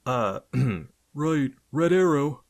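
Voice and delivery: high, loud fake goon voice; phony zombie voice. A narrator's reading. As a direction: ham up a tough goon. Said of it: "in a phony zombie voice".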